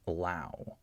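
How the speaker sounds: "allow" with a very quick, unstressed first vowel; reduced schwa. Shown as a demonstration. Teacher goes as fast as possible over the syllable, schwa in "allow". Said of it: "I'm trying to go as fast as possible".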